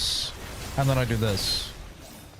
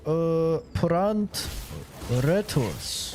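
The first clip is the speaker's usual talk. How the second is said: slow, drawn-out Japanese accent, pitched up; serious Japanese accent; 3 semitones higher, much slower than usual. Shown as a demonstration. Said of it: "So that in a serious Japanese accent?".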